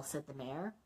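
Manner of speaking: plain reading voice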